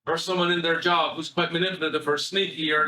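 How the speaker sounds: ordinary talk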